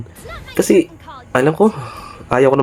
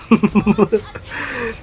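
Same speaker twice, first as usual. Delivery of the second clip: laugh; 8 semitones higher, 3 dB quieter than usual. Real, not acted. Mocking enemy.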